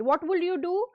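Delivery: normal talking voice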